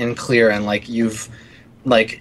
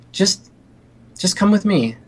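coaxing wife; 8 semitones higher than usual. Acted.

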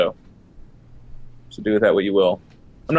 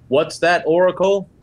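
higher and louder, feigned inquiry; mock consultation; 7 semitones higher, 4 dB louder, slower than usual. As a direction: pretend to ask an unseen oracle. Character mocks talking to Oracle.

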